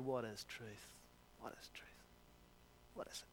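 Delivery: dropped low and soft, fading to a whisper; a tired, scornful shrug of a question; sarcastic, world-weary. Retold or imitated. Pilate scoffing at Jesus, sarcastic and world-weary. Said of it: "in a sarcastic, world-weary way".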